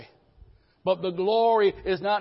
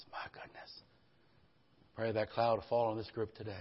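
soft, low, prayerful murmur; hushed prayer; 5 semitones lower, 4 dB quieter than usual. Acted. Pastor praying softly over the congregation, hushed and low. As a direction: pray it quietly over the room.

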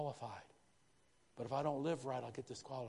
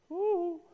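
much higher, much louder whoop; excited whoop; 10 dB louder than usual. Real, not acted. Preacher whooping at his own point to the congregation, loud and high.

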